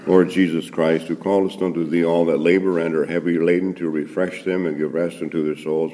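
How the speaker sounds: usual speaking voice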